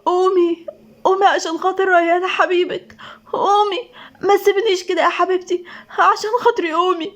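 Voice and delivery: high, tearful pleading; weeping, grief-stricken voice; crying. A narrator's reading. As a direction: beg through tears for her to wake. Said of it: "وقال بعياط".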